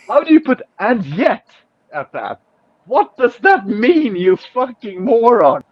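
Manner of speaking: exasperated. Real, not acted. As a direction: rant at the idiot in disbelief. Exasperated rant at the stranger.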